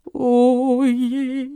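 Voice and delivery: high, drawn-out wail; woeful lament. A narrator's reading. Exhausted rector despairing in his office, wailing. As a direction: moan it, rising on the repeat. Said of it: "dachte er".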